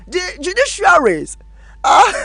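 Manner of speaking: real laugh creeping into the words; breaking into laughter. Real, not acted. Host cracking up over an award to the judiciaries, laughing. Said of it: "I don't know why I'm laughing".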